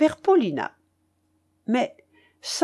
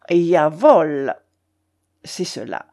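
drawled; 5 semitones lower, 3 dB louder, slower than usual. A narrator's reading. Narrator staring the baron in the eyes, drawling insolently. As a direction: drag out "Jawohl", then drop low. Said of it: "traînai-je".

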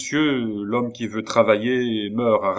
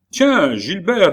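higher, louder, slow friendly surprise; good-natured greeting; 10 semitones higher, 7 dB louder, slower than usual. Acted. Young officer greeting Gilbert, good-natured surprise. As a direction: greet an old acquaintance warmly. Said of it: "dit-il avec bonhomie".

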